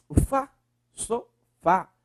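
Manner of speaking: fá in sofá drawn out slowly and leaned on; slow down and hit the fá in sofá; stress on fá. Shown as a demonstration. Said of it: "Qual é a sílaba mais forte".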